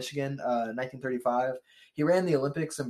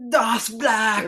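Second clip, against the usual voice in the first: higher, loud sputtering German; angry German accent; 8 semitones higher, 6 dB louder than usual. Retold or imitated. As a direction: shout it like a furious Hitler. Hitler voiced, fist shaking.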